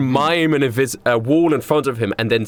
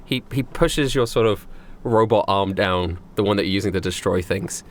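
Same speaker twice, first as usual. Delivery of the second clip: ordinary talk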